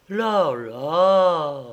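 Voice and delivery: drawn-out, mildly indignant protest; indignant exclamation. A narrator's reading. The impassive doctor objects to Stello, mildly indignant. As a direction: cry out with restrained indignation. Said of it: "s'écria le docteur Noir, avec autant d'indignation".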